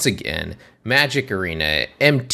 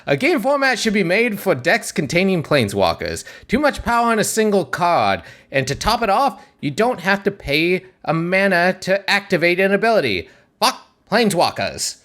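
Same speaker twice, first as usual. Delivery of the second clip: much higher carnival barker pitch; 10 semitones higher than usual. Shown as a demonstration. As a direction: sell it like a fairground barker. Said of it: "I'm going with it anyways".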